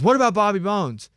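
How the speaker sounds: loud, high call to the attendant; calling out, pushy; mock shout. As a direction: Brett pleading loudly for parking. Brett calling out to parking attendant.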